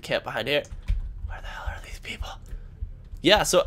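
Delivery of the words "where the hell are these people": fast hushed whisper. Real, not acted. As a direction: whisper it impatiently. Camping, whispering.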